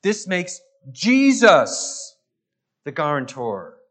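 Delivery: "This makes Jesus" slow and loud, weight on "Jesus"; emphatic, slow and loud on "Jesus"; stress on Jesus. Shown as a demonstration. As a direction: drag out and punch "Jesus". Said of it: "puts the emphasis on him".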